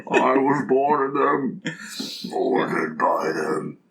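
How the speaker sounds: slow muffled grandfather voice, trailing off; hand over mouth. Retold or imitated. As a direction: talk slowly through a hand over the mouth. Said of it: "my grandfather voice with my hand over my mouth".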